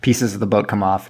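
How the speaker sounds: ordinary talk, nothing marked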